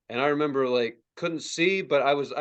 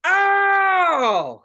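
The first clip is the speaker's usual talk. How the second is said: long, high, loud mock howl; pained howl; 8 dB louder than usual. Teen thawing hands over heater, howling in pain. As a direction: imitate a drawn-out shout of pain. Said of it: "'Cause it really hurts".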